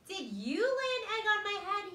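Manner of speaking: high, loud astonished question; indignant high-pitched question; surprised question. Acted. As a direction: ask in shocked disbelief. Surprised character asks bird.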